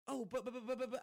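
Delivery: mock a flustered splutter; sputtering staff.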